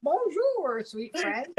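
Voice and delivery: high hammed-up French accent on the greeting. Host voices the skeleton card in a hammed-up French accent. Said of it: "I can just hear the accent already".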